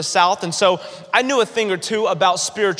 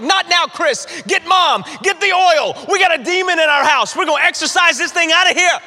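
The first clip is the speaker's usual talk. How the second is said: panicked yelling; 8 semitones higher than usual. Retold or imitated. Boy yells at his brother to fetch mom and oil, high and frantic. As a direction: bark orders in a frantic rush. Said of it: "I'm like".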